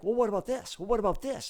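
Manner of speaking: high, loud challenging questions; confrontational client. Acted. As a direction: hammer them with questions, acting the client. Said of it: "maybe it gets a little confrontational".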